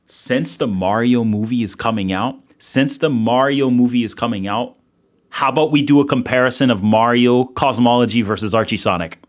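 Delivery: loud, hyped-up streamer delivery; rally the chat behind a big idea; excited pitch. Real, not acted. Hypes a new stream idea to chat.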